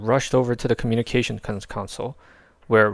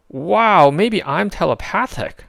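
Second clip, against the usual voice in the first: higher, louder awe; amazed wonder; 9 semitones higher, 6 dB louder, slower than usual. A narrator's reading. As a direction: gasp it in wonder. Rocket wonder.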